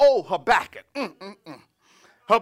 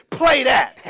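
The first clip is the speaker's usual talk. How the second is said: raised, loud preaching shout; exultant command; 5 semitones higher, 8 dB louder than usual. Retold or imitated. Preacher repeating the prophet's closing word, exultant command. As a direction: shout it out to the musicians. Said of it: "That's what he say".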